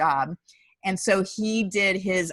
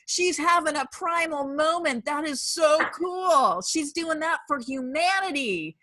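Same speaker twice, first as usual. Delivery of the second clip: admiring gush; 8 semitones higher, slower than usual. Retold or imitated. Voicing the admiring beach women.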